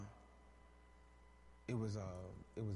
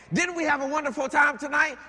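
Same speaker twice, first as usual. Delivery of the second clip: mock cheerful spouse; 11 semitones higher, 17 dB louder than usual. Mock quote of a pretending spouse.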